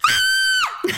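very high startled shriek; loud scream. Shown as a demonstration. Woman re-enacts the spider jumping out, loud high scream. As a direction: demonstrate a sudden piercing scream. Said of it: "like that, like, really loud".

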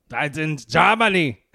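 slow, lightly put-on German accent on "That's in Germany"; a hint of a German accent, slow; mock German accent. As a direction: drag it out in a hammed-up German accent. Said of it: "that's my German accent".

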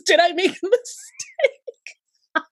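high, drawn-out mock wail; mock anguish. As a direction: stretch it into a high-pitched, hammed-up lament. Acts out her anguished second thoughts.

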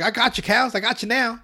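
Barbrady gloating. Retold or imitated. Officer Barbrady cornering the cows, high gloating. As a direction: crow like a dim cop.

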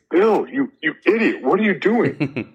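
high, incredulous scolding, slowing on the last words; mock-outraged at a friend's stupid move; exasperated disbelief. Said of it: "he's like, he's like".

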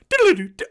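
high, loud rewind jingle; cartoonish high-pitched rewind effect; rewind noise. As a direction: sing out a silly tape-rewind sound. Voices rewind sound effect.

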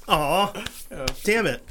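usual speaking voice

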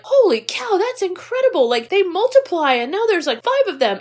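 high-pitched mock wonder; mock amazed. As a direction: gush over it, wide-eyed. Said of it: "Billy was like".

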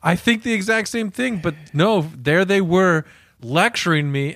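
ordinary delivery

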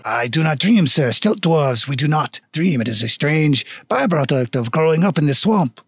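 put-on deadpan stilt dwarf with French accent; matter-of-fact mock-French delivery; bad French accent. Stilt dwarf explains himself to a customer, bad French accent. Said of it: "very shitty French accent".

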